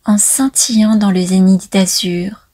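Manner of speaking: hushed, falling cadence at line ends; poetry recital. Acted. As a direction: recite it line by line with long pauses. Commencer cette danse poétique par un poème.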